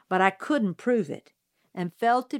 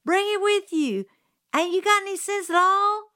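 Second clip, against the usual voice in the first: high, loud exasperated holler; 11 semitones higher, 4 dB louder than usual. A narrator's reading. Jim hollers at Scout in exasperation. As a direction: bawl her out. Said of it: "Jim hollered".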